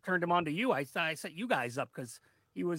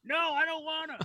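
high silly whine; 12 semitones higher than usual. Acted. Playful refusal in high voice.

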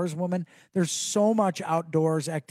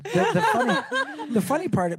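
much higher, laughing through it; 14 semitones higher than usual. Real, not acted. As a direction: giggle as you start the sentence. Laughing while starting.

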